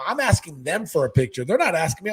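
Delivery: ordinary delivery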